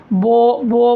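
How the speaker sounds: बो with the aa rounded into o; rounded wrong vowel. Shown as a demonstration. Teacher demonstrates another mistake, rounded बो. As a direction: round the lips so it comes out बो. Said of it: "ये भी गलत है".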